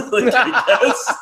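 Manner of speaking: slow, laughing shrug. Real, not acted. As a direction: let the laugh carry it, slowly. Laughing at the offer.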